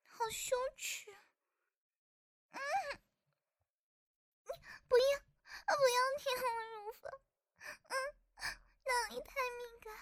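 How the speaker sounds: high, rushed, breaking protests; flustered pleading. Acted. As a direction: beg them to stop, half crying. Flustered protests during the act.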